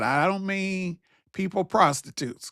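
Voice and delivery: ordinary delivery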